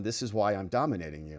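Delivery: deadpan troll. Acted. Troll dropping a line on someone furious, deadpan. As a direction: drop it in quietly, understated troll. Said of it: "then somebody drops on".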